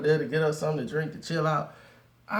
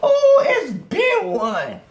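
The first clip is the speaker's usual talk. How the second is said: "Oh, it's been one" high, loud and slow, dragged out; mock girl catchphrase; 14 semitones higher, 7 dB louder, much slower than usual. Girl in the car sighing "it's been one", high and drawn out.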